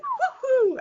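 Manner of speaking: wolf-whistle cheer. Real, not acted. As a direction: whoop like a catcall. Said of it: "I can't whistle like that".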